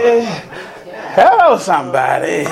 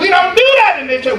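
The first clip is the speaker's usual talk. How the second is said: mock old church folk; 10 semitones higher, much faster than usual. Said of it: "them old sanctified folks saying".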